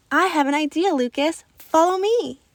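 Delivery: excited. Acted. Child eagerly calls the overwhelmed boy to follow her. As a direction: call him along eagerly. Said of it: "I said excitedly".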